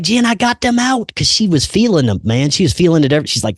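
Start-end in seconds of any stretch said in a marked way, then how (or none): 0.0-1.1 excited news. Retold or imitated. Announcing victory to wife.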